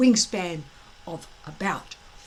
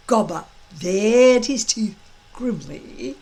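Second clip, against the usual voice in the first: ordinary delivery, nothing marked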